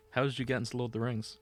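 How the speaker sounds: ordinary talk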